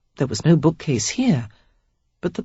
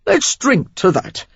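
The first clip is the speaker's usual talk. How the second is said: slow, higher Cockney toast; 7 semitones higher than usual. A narrator's reading. Stranger offering Sarah wine, slow Cockney toast. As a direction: raise a glass like a London rogue. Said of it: "a healthy dose of Cockney in his accent".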